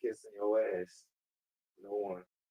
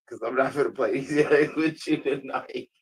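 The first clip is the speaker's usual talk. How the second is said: laughing; 5 semitones higher, 5 dB louder than usual. Real, not acted. Laughing rant at the assistant.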